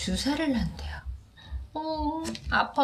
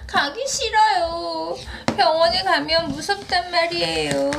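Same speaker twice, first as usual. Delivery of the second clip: whiny scared bunny; 7 semitones higher than usual. Acted. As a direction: plead like a frightened little bunny. Bunny refuses hospital.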